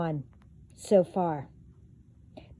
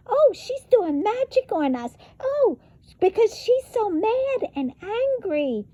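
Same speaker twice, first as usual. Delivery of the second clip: very high, squeaky mock voice; mocking the haters; 13 semitones higher than usual. Mimicking the people calling her mad, squeaky mockery. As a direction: parody them as whiny gossips. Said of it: "Don't give me all that".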